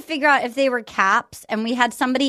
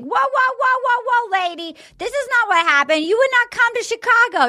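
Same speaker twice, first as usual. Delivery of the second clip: much higher, startled "whoa whoa" protest; high mock protest; 11 semitones higher than usual. Joe cutting off his ex's version of the breakup, high and incredulous. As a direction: throw up your hands in disbelief, pitched way up.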